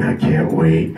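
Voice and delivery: low gleeful devil; a low eager little devil. Retold or imitated. The devil on his shoulder, low and gleeful. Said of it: "The devil in my ear is going".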